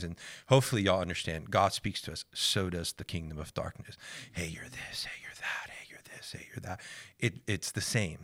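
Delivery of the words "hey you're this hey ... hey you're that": hissing whisper of a tempting voice; whispered accuser. Retold or imitated. Voicing the kingdom of darkness.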